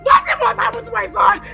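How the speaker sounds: crying plea. Acted. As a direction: beg through sobs. Mother pleading in tears.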